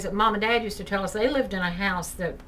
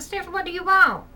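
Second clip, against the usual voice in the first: raised, higher call; calling through a door; 9 semitones higher, 4 dB louder than usual. Retold or imitated. Mother calls to daughter in the shower, higher and louder. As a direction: call out to someone behind a door.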